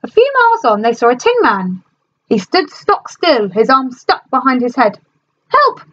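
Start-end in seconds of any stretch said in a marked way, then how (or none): 5.5-5.8 very high strained cry; grunting tin man. A narrator's reading. Rusted Tin Man calling for help, very high and strained. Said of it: "he grunted".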